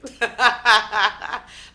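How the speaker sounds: real laugh. Real, not acted.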